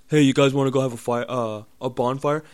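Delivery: a breezy bonfire invite, mocked; mock party invite. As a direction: mimic the clueless guy who wants a fire. Imitating people proposing a bonfire.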